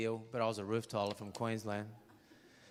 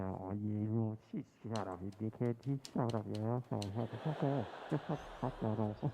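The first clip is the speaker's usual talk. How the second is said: muffled argument; 3 semitones lower than usual. Retold or imitated. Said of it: "this muffled argument".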